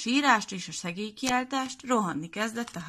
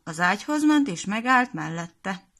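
plain reading voice

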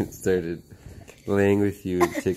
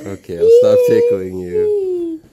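high, loud, laughing squeal; 11 dB louder than usual. Real, not acted. Laughing squeal while tickling.

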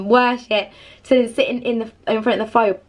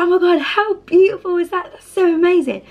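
higher, bubbly amazement; gushing wonder; 8 semitones higher than usual. Retold or imitated. Chiming in with the stranger about the fire, gushing higher voice. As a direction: gush over the flames with a new friend.

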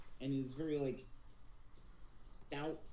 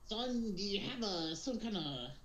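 pirate; 6 semitones higher than usual. Acted. Captain questioning a centaur, higher pirate voice. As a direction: puzzle it out in a raised pirate voice. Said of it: "being able to do a pirate voice".

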